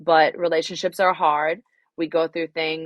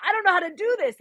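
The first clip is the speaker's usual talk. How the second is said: mock flustered panic; 11 semitones higher than usual. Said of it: "And I was like".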